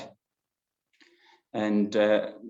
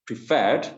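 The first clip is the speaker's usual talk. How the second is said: "preferred" with FERRED louder and swooping in pitch; stress on second syllable; 2 semitones higher, 4 dB louder than usual. Shown as a demonstration. Teacher showing stress in the second syllable, stressed "preferred". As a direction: punch the end of "preferred". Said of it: "in the second syllable".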